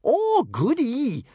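slow, very high, loud glee; delighted. A narrator's reading. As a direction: relish it with delight. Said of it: "absolutely delighted at the thought".

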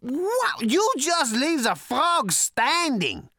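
very high, loud, drawn-out raving; excited shouting. A narrator's reading. Prack raves to Arthur, shouting in hysterical delight. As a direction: shout in helpless, giddy admiration. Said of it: "he shouted".

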